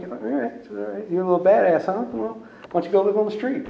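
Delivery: lightly voiced staff taunting a smart-aleck teen; mocking. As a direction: talk down to the kid, mock-tough.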